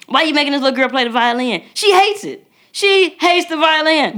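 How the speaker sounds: high, exasperated jab at a pushy parent; mock scolding. Scolding another mom at a violin lesson, high and exasperated.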